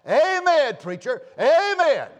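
high, booming congregational amen; ringing, full-voiced amens; enthusiastic amen. Shown as a demonstration. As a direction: shout it out in support of the preacher. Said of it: "you ought to be willing to say".

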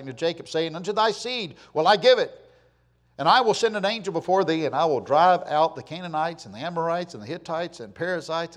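declaimed Bible reading in strong, rising swells; preacher's scripture reading. Acted. Preacher reads God's command to Moses, scripture reading. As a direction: proclaim the verse from the pulpit.

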